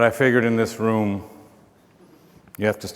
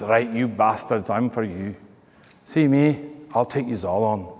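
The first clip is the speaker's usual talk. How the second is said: defiant Scottish challenge, not raised; Scottish Highlander; 4 dB quieter than usual. Acted. Highland gentleman challenging the English soldiers, Scottish accent. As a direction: take them on in a put-on Scots accent. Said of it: "Highland gentleman says".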